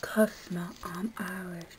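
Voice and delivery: soft unvoiced whisper; breathy hushed aside. Real, not acted. Whispered aside after long pause.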